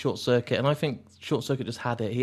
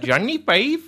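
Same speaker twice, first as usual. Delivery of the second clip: exaggerated mock Indian accent, higher; bad Indian accent; 8 semitones higher, 4 dB louder, slower than usual. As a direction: lampoon a terrible Indian accent. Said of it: "the worst ever Indian accent".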